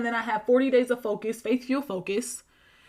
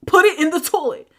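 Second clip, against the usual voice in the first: louder, higher insistent repeat; emphatic command; 6 semitones higher, 8 dB louder than usual. Retold or imitated. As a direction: drive the order home forcefully. Repeats the order more forcefully.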